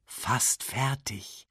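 soft, slow reassurance; kindly old baboon. A narrator's reading. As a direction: soothe the little cub kindly. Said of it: "sagte der alte Pavian gütig".

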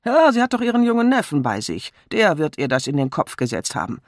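loud, high snort of dismissal. A narrator's reading. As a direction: snort it out like a scornful colonel. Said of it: "Der Colonel schnaubte". (verächtlich schnaubend)

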